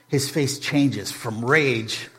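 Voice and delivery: ordinary talk, nothing marked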